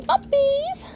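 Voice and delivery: high, bright call to the pups; excited call to puppies. Real, not acted. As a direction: call them over with delight. Calling to puppies.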